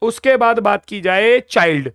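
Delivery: normal talking voice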